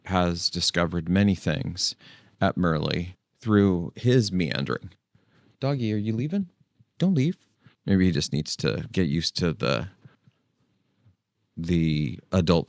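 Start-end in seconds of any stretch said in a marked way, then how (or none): 5.6-7.4 coaxing the dog. Real, not acted. Owner coaxing the dog to stay, high sweet voice. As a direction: plead with the puppy not to go.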